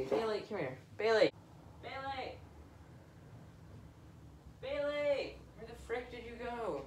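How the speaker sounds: off-mic searching call; calling a pet. Real, not acted. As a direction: call out while away from the mic. Calling the cat away from mic.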